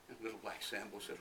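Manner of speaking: plain reading voice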